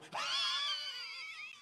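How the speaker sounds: very high, drawn-out shriek; outraged screech. Acted. Snubbed by an empty cab, high screech. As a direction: let out one long high screech. Cab drives right past.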